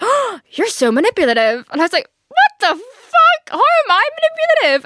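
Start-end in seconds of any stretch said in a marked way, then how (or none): 0.0-1.6 loud gasp, then higher mock-offended voice; shocked accusation. Ex accusing her as she throws him out, mock-shocked. 2.3-4.9 very high, loud retold retort; outraged shriek. Girlfriend answering ex's accusation, outraged shriek. Acted furious retort in quarrel.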